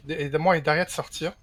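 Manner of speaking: ordinary talk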